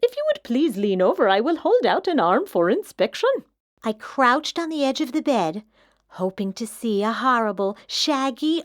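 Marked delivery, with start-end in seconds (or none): 0.0-3.3 louder, smooth and silky introduction; a prim monster showing off; well-groomed monster offering its claws, silky. A narrator's reading. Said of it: "he said in a high, silky voice".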